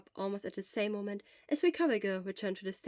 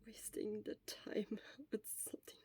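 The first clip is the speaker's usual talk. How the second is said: hoarse sick whisper, barely any voice; Shota's voice; 3 dB louder than usual. Acted. Shota whispering weakly to his friend from the sickbed. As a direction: whisper it like a sick man worn out.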